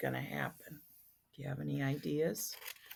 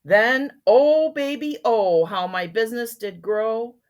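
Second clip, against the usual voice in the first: louder, emphatic storybook reading; story narration; 7 semitones higher, 15 dB louder, slower than usual. A narrator's reading. Once-ler boasting of his growing business, emphatic storybook narration. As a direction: belt out the line with relish.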